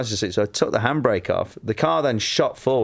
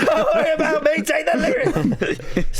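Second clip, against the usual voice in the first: hammed sacrifice. Mock heroic sacrifice.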